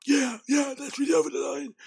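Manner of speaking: hammed-up impression of a fake tough guy; mock fake deep bro voice. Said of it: "fake, deep voice thing".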